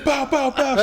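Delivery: high, loud gunshot noises through laughter; mock air-gun ad-libs. Joking about her doing air guns, loud mock ad-libs. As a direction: shout out rap gun sounds, laughing. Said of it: "She doing the air guns?".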